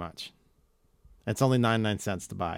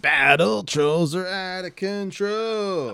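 slow announcer voice repeating slogan; toy commercial; 7 semitones higher, slower than usual. Retold or imitated. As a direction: echo the ad tagline like a TV announcer. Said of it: "that commercial".

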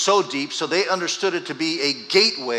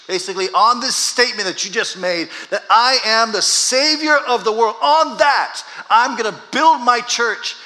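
rising, louder, higher preaching; higher and louder, slowing on build my church; preaching at full force; 9 semitones higher, 7 dB louder than usual. Acted. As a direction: build to a climax.

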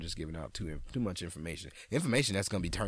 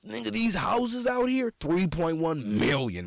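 excited; 10 semitones higher, 7 dB louder than usual. Real, not acted. Excited about pricey houses.

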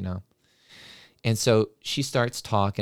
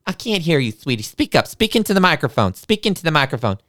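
high, insistent sugary teacher; pushy teacher voice; 7 semitones higher, 4 dB louder than usual. Retold or imitated. Teacher pushing the shy boy to use the mic, high and insistent. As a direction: coax him loudly to speak up.

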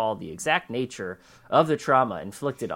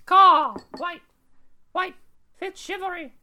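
high squawking crow cries, much louder; Moira Rose bird voice; 8 dB louder than usual. Retold or imitated. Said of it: "Moira. Moira Rose".

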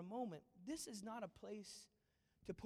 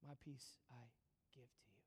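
hushed whispered promise; 7 semitones lower, 15 dB quieter than usual. Acted. Pastor whispering Jesus's promise to the congregation. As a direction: breathe the words softly to the congregation.

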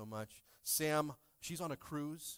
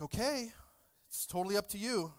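mock innocent; 6 semitones higher than usual. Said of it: "I was like".

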